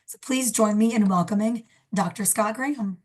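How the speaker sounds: ordinary talk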